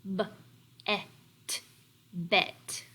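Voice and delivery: "B", "et", then blended "bet"; teacher leading the class in blending "bet"; choral sound-out. Shown as a demonstration.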